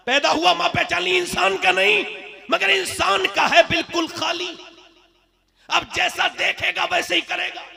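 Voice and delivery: high, insistent oratory; high-pitched, emphatic sermon delivery. Real, not acted. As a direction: press the warning on the crowd.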